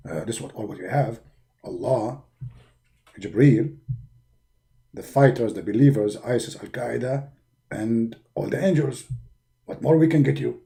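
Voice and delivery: very low, hushed angel voice; Jibreel's voice. Acted. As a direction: speak deep and hushed. Jibreel lists support.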